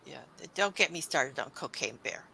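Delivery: ordinary delivery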